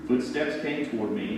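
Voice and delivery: plain reading voice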